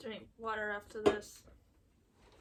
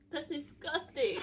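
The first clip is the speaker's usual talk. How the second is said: disgusted shout; slower than usual. Real, not acted. Disgust after sour soda.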